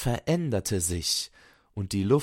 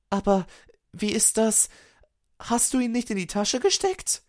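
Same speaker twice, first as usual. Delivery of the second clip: very high-pitched, startled elf voice; alarmed young elf; 13 semitones higher than usual. A narrator's reading. Fenja to Arvid about the missing frost crystal, alarmed. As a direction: question it high, in dismayed disbelief.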